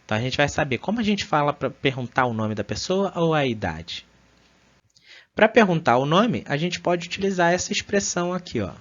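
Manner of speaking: usual speaking voice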